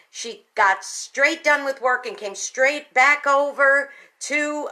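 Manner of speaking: normal talking voice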